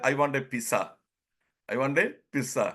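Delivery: pizza said with a hard ts, mock request; lightly voiced younger self asking for pizza; imitating own old accent. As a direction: replay the wrong pizza at the shop. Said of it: "I said".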